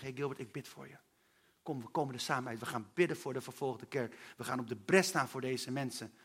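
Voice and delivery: soft, low reassuring friend; tender friend. Retold or imitated. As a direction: say it gently, like a caring friend. Vriend die voor hem bidt. (zacht en geruststellend als een vriend)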